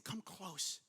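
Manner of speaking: very soft, low plea; hushed invitation. Acted. Joseph saying it to his brothers, hushed invitation. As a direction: drop right down and draw them in.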